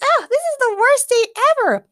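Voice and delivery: high, loud wailing complaint; Morgana despairing. Acted. Morgana wailing to everyone, despairing. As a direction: wail it like the world is ending.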